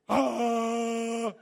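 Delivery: long, loud, held dumbstruck "uh"; hammed-up frozen stare as a sound; deer-in-headlights noise. Preacher on a big stage before her, mock frozen groan. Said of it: "the big deer in the headlights".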